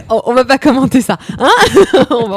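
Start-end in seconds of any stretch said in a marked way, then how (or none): 1.5-2.1 high loud laugh bursting out; sudden high-pitched loud laugh; burst of laughter. Real, not acted. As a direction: crack up at the joke. Laughing at crude joke.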